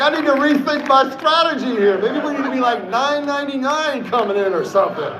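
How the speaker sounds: high-pitched laughter breaking through the words; laughing while quoting. Real, not acted. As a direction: laugh through it, tickled by the mix-up. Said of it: "I start cracking up".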